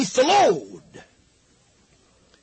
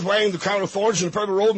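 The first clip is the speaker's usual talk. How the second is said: ordinary delivery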